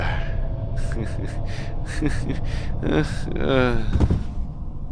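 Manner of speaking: long, breathy unvoiced laugh; a long giggle that keeps going. Long laugh after the hand goes through the hologram.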